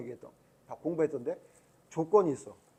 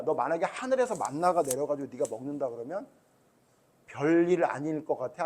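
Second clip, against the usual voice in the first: ordinary talk, nothing marked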